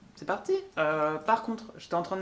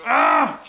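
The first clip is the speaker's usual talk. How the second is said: loud annoyed cry; short burst of frustration; frustrated yell; 7 semitones higher, 15 dB louder than usual. Real, not acted. Filming fails again.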